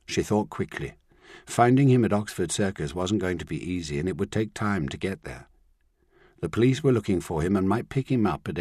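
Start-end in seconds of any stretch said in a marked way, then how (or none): none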